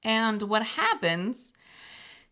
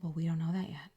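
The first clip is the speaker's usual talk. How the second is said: whisper; 4 semitones lower, 9 dB quieter, faster than usual. Real, not acted. Whispered aside.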